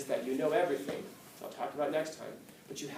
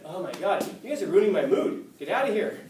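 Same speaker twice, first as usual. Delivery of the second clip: louder mock exasperation; exasperated; 4 semitones higher, 6 dB louder than usual. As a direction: act out Socrates fed up with the mourners. Imitates Socrates irritated at crying mourners.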